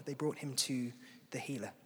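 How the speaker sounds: hushed whisper, no voice at all; a soft whisper to draw them in. Shown as a demonstration. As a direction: breathe "They brought him to the healer" as a secret. Repeats line, second time whispered.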